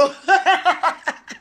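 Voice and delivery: high, breathy fit of real laughter; burst of laughter. Real, not acted. Woman cracks up telling friends about the man, laughing. Laughing at her own story.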